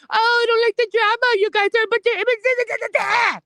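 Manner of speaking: high, fast whiny mock voice; shrill-pitched sarcastic mimicry, quick; mocking imitation. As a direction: parody a prissy hypocrite. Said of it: "wanna sit there and go off and".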